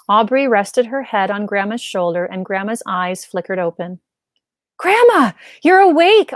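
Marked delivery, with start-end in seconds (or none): 4.8-6.3 much higher, louder joyful cry; exclaiming. Acted. Granddaughter seeing grandma wake up, relieved exclamation. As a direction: burst out with relief. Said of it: "Aubrey exclaimed".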